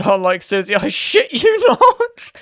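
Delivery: high, slow, drawn-out, laughing through it; mock-earnest punchline. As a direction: stretch it out high and slow, cracking up. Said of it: "customer support says".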